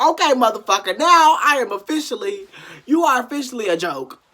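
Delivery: loud, high indignant rant; furious at being played; outraged. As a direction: let the fury rise. Outraged inner reaction.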